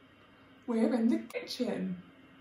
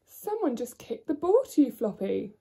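normal talking voice

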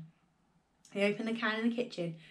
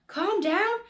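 much higher, louder, outraged question; indignant cry; 11 semitones higher, 6 dB louder than usual. A narrator's reading. Father answering his wife, outraged and much higher. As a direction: fling it back in disbelief. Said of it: "cried Mister Cranky".

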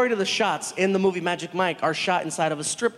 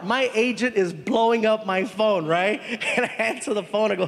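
normal talking voice

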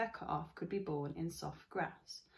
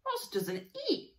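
put-on much higher, slow, know-it-all boy voice; young boy; slower than usual. Brother correcting his sister, higher slow boy voice. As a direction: state it like a know-it-all kid. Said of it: "said Jad".

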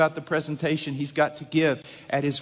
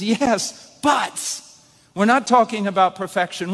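emphatic preaching; 5 semitones higher, 5 dB louder than usual. Acted.

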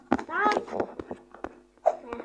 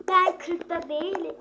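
louder repeat; 3 semitones higher, 7 dB louder, slower than usual. Real, not acted.

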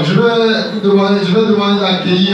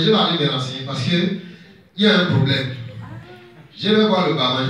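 ordinary delivery

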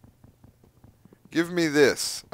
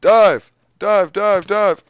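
high, loud repeated command; urgent shouting; 6 semitones higher, 9 dB louder, slower than usual. Real, not acted. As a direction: shout the order to dive. Urgent command during fight.

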